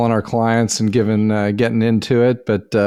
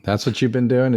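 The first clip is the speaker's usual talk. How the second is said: normal talking voice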